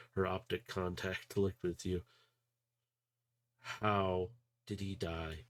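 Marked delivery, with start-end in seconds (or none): 3.6-5.4 slow, quiet, each word set down; hollow-voiced question through grief; numb demand. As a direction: stare him down and wait. Addie stares straight at Gilgamesh.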